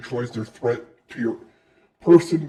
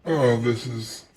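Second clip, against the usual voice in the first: usual speaking voice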